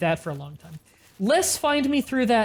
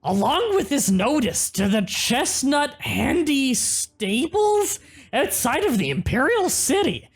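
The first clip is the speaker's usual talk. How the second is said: Gremlin voice; 5 semitones higher than usual. Acted. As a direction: read it like a grinning gremlin. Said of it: "You can read horse armor note in Gremlin voice".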